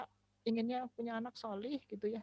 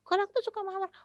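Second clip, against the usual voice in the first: put-on much higher little-kid voice; child explaining; 12 semitones higher, 8 dB louder, faster than usual. Child explains to mother what mean is, high little voice. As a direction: explain it simply like a small kid.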